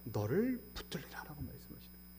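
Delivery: hushed whisper of the promise. Acted. Pastor whispering God's promise to hold them. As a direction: breathe the words softly.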